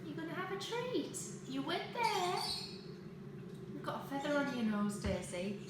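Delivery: high sing-song voice for the dog; talking to a dog. Real, not acted.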